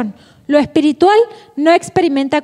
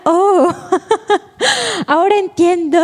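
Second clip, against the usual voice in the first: a laughing, pleased little eureka; lightly acted joy with a laugh; delighted realization; 3 semitones higher than usual. Student finally solving a math problem, laughing delight. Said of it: "decía".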